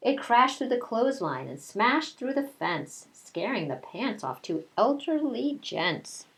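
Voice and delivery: storybook read-aloud voice; reading to children. A narrator's reading. Teacher reading a picture book to her class, storytelling voice.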